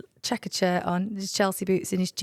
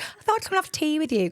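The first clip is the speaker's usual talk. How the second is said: imitating mum; 9 semitones higher than usual. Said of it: "She's like".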